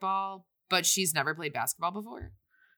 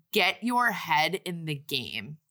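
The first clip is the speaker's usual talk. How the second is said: slow, lower-pitched coach line; measured coach quote; 4 semitones lower, slower than usual. Retold or imitated. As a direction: deliver the coach's line slowly and low. Quoting coach, first take.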